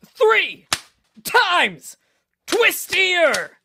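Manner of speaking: very high scream, word by word; screaming each word. Acted. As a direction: scream one word per smack. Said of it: "Rash screams a word with each smack".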